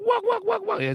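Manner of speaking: usual speaking voice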